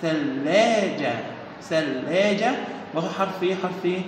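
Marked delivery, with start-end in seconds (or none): 0.0-1.1 drawn-out word. Shown as a demonstration. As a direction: stretch out ثلاجة very slowly, syllable by syllable. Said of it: "آخر صورة يا ولاد صورة إيه؟".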